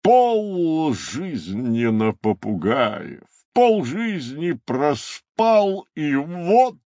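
slow, swooping theatrical lament; sighing rhinoceros. A narrator's reading. Rhinoceros regrets his half-slept life, sighing lament. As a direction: draw out each phrase with feeling. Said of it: "вздохнул носорог".

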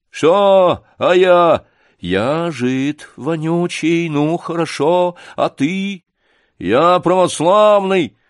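high, loud, drawn-out bluster; indignant bluster. Acted. Гундосый to Сашка in the quarrel, indignant bluster. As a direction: answer back slowly and loudly, affronted. Bully answering back in quarrel.

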